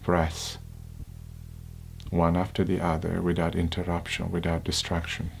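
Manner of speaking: unhurried, hushed guidance, phrase by phrase; guided meditation. Acted.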